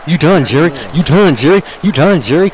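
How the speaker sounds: loud, high, repeated taunt; trash-talking Jerry Lawler in a loud chant; taunting shout. Real, not acted.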